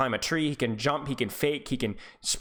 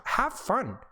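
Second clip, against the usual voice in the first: unvoiced, higher whispered "have fun"; 8 semitones higher than usual. Real, not acted. Whispered encouragement, then repeated voiced.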